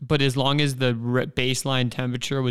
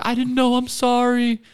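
high-pitched, louder retold apology; guilty apology; 10 semitones higher, 5 dB louder than usual. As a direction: plead sorry in a high voice, acting it out. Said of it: "So I felt so bad".

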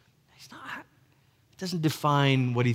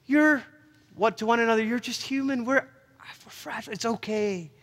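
put-on tender, like to a small child; 11 semitones higher than usual. Pastor voicing God tenderly soothing his fragile children. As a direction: coo it gently, like a parent soothing a small child. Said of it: "And with such tenderness".

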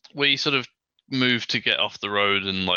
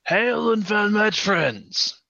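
a hearty knight's greeting; in-character knight; 8 semitones higher, 3 dB louder, much slower than usual. Acted. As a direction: hail them like a knight, higher and a bit louder. Player speaks in character to NPCs.

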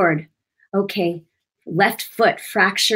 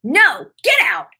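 commanding rebuke; 6 semitones higher, 4 dB louder, slower than usual. Shown as a demonstration. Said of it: "You have to say".

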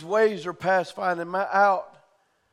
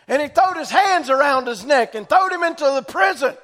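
raised, ringing preacher's voice building to a shout; preaching at full force; 6 semitones higher, 4 dB louder than usual. Acted. Preacher calling out to his church, fervent and loud. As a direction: preach it out to the congregation, rising.

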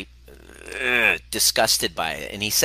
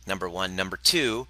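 normal talking voice

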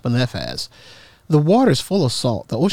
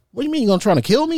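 mock alarm; 12 semitones higher, much faster than usual. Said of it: "And I said".

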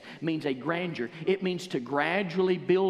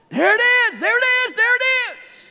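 much higher and louder triumphant cry; joyful shout; 12 dB louder than usual. Acted. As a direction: yell it to the whole crowd. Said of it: "growing... with their words".

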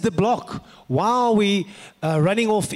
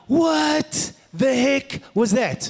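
astonished; 7 semitones higher, much slower than usual. Retold or imitated. Stunned young man reacting.

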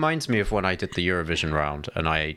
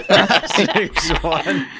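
laughing; 10 semitones higher, 7 dB louder, much slower than usual. Real, not acted. Laughing at a friend's poem.

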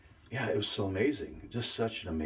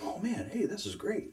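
higher, thrilled "oh man, hey"; excited discovery; 12 semitones higher than usual. Retold or imitated. Retelling hearing the radio sermon, excited and high. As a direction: gush like you just found a treasure. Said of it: "I'm like".

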